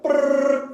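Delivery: high loud buzzing brrr; weed eater noise. Retold or imitated. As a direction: mimic a trimmer's whir. Weed eater noise killing snakes.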